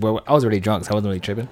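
usual speaking voice

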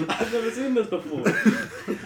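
loud, high and slow through laughter; laughing riff. Pretend. Friend joining a mock autopsy bit, laughing loudly. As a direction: join the joke, cracking up.

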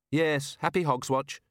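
lightly voiced Susan; Susan replies. Susan answers.

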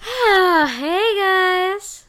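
loud, high, drawn-out greeting in an off voice; weird voice. Real, not acted. Next speaker greeting everyone before telling her story, weird-sounding voice. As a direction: call out high and loud with an odd voice. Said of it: "sorry about my voice. My voice is weird".

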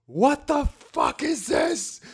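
high, loud, slow shrieking mom; shocked mom. Retold or imitated. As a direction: shriek it like a horrified mother. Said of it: "She was like".

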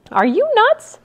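high, loud disbelieving retort; incredulous outburst. A narrator's reading. Brother rejecting sister's plan, loud disbelief. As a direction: shoot the idea down.

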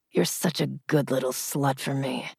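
low, soft murmur. A narrator's reading. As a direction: breathe it out close and quiet. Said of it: "he murmured against my lips".

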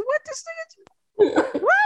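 very high, slow, laughing question; laughing disbelief. Retold or imitated. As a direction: squeak it out through laughter. Retold confusion, laughing.

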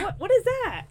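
high, loud incredulous reaction; retold shock, loud and high; mock astonishment. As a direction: act out being baffled, pitch leaping up. Acting out startled reaction to baby.

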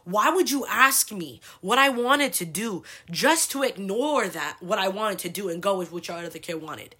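ordinary talk, nothing marked

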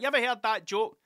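excited pub-joke opener; eager joke opener. Retold or imitated. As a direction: sell the setup with glee. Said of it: "It's like".

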